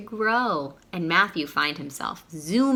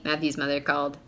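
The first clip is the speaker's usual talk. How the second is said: plain reading voice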